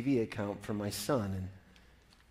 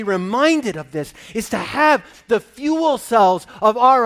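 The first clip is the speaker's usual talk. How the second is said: louder, higher, driving sermon delivery; full-force preaching; 7 semitones higher, 13 dB louder than usual. Acted. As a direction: build the sermon up with rising fire.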